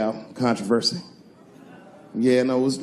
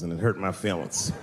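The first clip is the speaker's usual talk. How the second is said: normal talking voice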